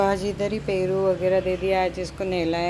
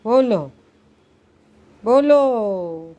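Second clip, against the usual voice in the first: louder, the second one long and stretched; mock demanding; 4 semitones higher, 7 dB louder, much slower than usual. Mother playfully demands her hypnotized cat speak, loudly. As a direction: press the cat for an answer.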